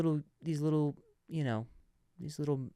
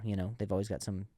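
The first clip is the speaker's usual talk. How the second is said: ordinary talk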